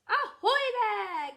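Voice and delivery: high, loud, drawn-out hail; calling out. A narrator's reading. Grandpa Pig hails the family from his boat, calling out.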